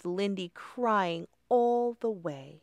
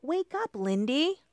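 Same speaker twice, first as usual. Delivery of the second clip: urgent plea; 7 semitones higher than usual. Acted. Molly rouses fainted friend.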